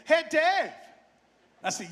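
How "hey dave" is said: high-pitched mock Canadian accent. Fiddler calling the driver from the back, mock Canadian accent. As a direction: imitate a bandmate in a Canadian accent. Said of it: "I'll do my best Canadian accent".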